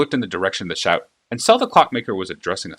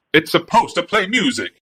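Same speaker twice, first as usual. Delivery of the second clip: higher, louder gushing shopkeeper; fawning clockmaker; 9 semitones higher, 4 dB louder than usual. A narrator's reading. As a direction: gush over the princess like an eager flatterer. Clockmaker panics.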